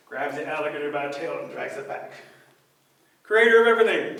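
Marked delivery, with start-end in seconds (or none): 3.2-4.2 loud, higher hail; raised, announcing rabbit voice; calling out. Acted. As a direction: call up to the creator. Rabbit calls the creator.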